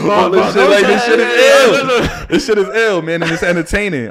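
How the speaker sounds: loud, high-pitched outburst of enthusiasm; excited raving. Real, not acted. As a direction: rave about it at near-shouting volume. Raving about stories he enjoys.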